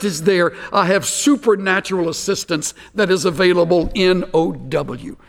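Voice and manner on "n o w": "N O W" spelled slowly letter by letter; each letter of "N O W" set apart. Shown as a demonstration. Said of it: "I love these little words".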